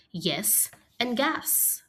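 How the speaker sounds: usual speaking voice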